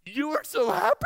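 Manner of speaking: very high, loud mock disbelief; astonished old lady. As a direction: squeal the question, imitating an amazed stranger. Repeats stranger's astonished outburst.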